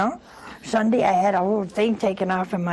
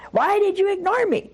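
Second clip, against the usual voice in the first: much higher, baffled repeat of the question; incredulous echo; 11 semitones higher than usual. Retold or imitated. Woman repeats her friend's accusation, baffled and much higher. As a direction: throw it back in total disbelief.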